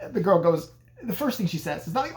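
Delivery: usual speaking voice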